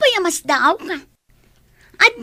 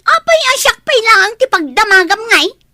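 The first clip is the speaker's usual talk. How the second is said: loud, sharp scolding of the sibling; angry scolding; 4 semitones higher, 8 dB louder than usual. Acted. Big sister yelling at her little brother, loudly. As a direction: snap at him for asking.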